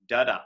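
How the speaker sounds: "Da-da" with the second da stressed; iamb stress. Shown as a demonstration. Teacher showing students what an iamb is, "Da-da" stressed on the second. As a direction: say "Da-da" as weak beat, then strong beat. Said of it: "an unstressed followed by a stress".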